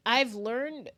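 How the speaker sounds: normal talking voice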